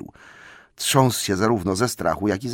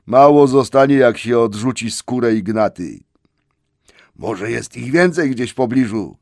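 gruff trolls; 3 semitones higher, 7 dB louder than usual. Acted. Trolls argue over the hobbit, loud and gruff. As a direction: grumble big and loud.

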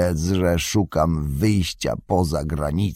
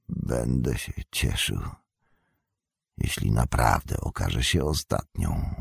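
low bitter Saruman; 9 semitones lower, 6 dB quieter than usual. A narrator's reading.

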